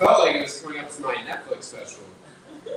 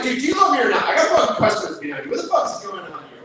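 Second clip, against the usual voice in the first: high, loud demanding rant; exasperated yell; 12 semitones higher, 9 dB louder, faster than usual. Retold or imitated. Barista confronting a confused customer, loud exasperated. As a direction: confront him, fed up and loud. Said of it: "I was like".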